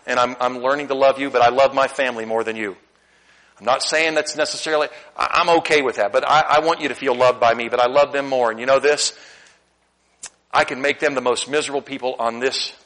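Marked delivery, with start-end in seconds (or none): none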